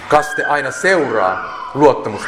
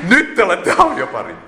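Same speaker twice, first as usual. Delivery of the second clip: higher acted announcement; mock proclamation; 6 semitones higher than usual. Congregation greets the returning newlyweds, mock proclamation. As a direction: proclaim it in a raised pitch, put-on voice.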